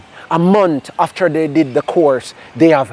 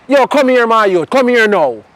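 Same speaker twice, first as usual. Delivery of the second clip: commanding call; 5 semitones higher than usual. Retold or imitated. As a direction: call someone over like you're in charge. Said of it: "What kind of tone Nicholas calling me in".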